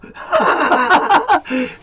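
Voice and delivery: high, loud burst of laughter; a big cackle up high. Real, not acted. Laughing at his own Jeopardy joke, a loud high cackle.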